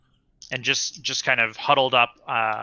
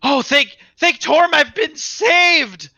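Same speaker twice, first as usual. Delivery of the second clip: relieved cry; 14 semitones higher, 7 dB louder, much slower than usual. Acted. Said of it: "he goes".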